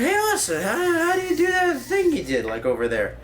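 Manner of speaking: high, whiny asking voice; imagined viewer voice. Pretend. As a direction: pitch way up as the needy viewer. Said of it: "and you're wondering".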